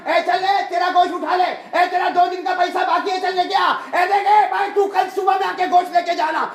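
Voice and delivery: high, loud, rapid-fire butcher's shout; yelling loudly. Retold or imitated. Butcher yelling at customers to take their meat, shouting. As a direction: holler it out at full volume, high-pitched. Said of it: "इतना जोर जोर से चिल्ला चिल्ला के बोल रहा".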